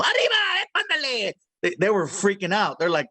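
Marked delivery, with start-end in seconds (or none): 0.0-1.3 high, excited cartoon mouse cry; Speedy Gonzalez voice. Shown as a demonstration. Voice actor showing producers his Speedy Gonzalez. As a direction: shout it like the fastest mouse in Mexico. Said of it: "you know".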